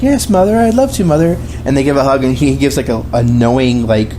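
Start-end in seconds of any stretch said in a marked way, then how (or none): 0.0-1.4 mock sweet Cartman. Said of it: "he's like".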